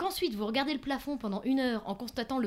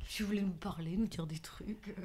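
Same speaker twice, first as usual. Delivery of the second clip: whispered aside; 4 semitones lower, 4 dB quieter than usual. Real, not acted. Confiding aside to audience.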